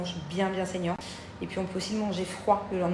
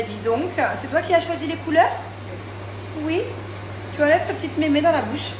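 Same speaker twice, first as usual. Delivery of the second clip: high sweet mom voice; 5 semitones higher, 5 dB louder than usual. Real, not acted. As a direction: coo over the gift like a proud mom. Mother talking to child.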